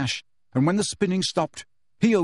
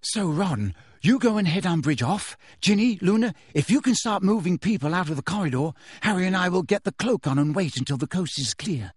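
brisk higher voice giving orders; Hermione instructing; 4 semitones higher than usual. A narrator's reading. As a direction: take charge of the plan.

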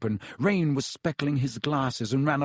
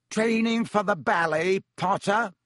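higher, louder taunting call; mocking yell; 7 semitones higher, 3 dB louder than usual. Malfoy taunting Harry mid-flight, mocking yell. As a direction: jeer at him across the pitch. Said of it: "yelled Malfoy".

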